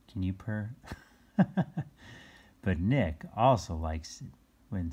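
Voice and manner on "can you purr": ordinary delivery, nothing marked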